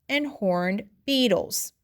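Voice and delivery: ordinary delivery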